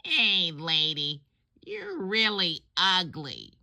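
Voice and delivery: high squawky parrot taunting the lady. Acted. Parrot in the pet store window mocking a passing lady, high squawky voice. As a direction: squawk it out like a cheeky parrot, ending in a shrill What. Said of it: "it says".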